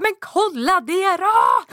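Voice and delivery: high, frantic and slow insistence; high, desperate demand. Retold or imitated. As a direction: plead hard, almost in tears. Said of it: "Jag bara". (desperat krav nära gråten)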